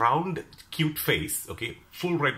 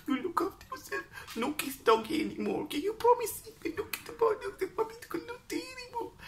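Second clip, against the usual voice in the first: put-on very high, fussing Chinese accent; caring Chinese accent. Stall owner touching her face, high caring Chinese accent. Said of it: "that strong Chinese accent".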